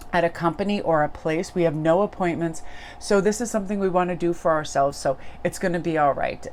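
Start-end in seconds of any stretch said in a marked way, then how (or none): none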